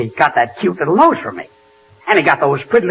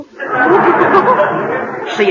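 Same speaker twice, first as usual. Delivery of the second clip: big laugh; 12 semitones higher than usual. Real, not acted. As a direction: crack up at the insult. Laughs at the joke.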